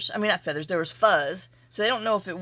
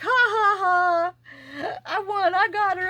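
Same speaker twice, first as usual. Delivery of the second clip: high, loud gloating cackle; gloating rooster; 11 semitones higher, 3 dB louder, slower than usual. Retold or imitated. Rooster gloating that he trapped her, high gloating voice. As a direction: crow over your win like a cocky rooster. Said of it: "'cause he's like".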